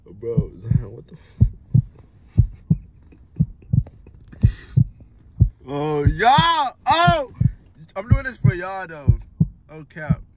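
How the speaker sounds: loud, high outraged shouts; shocked yelling. Real, not acted. Boyfriend yelling at her reply in shock. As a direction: yell in disbelief.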